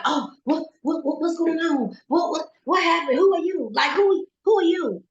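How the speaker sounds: high, stammering mock-panicked questions; panicking. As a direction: imitate a bewildered man, voice jumping high and words repeating. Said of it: "He was panicking".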